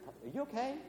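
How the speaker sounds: soft, high-pitched gentle question; imitating concerned wife. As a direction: lightly voice a worried wife checking in. Voices his concerned wife.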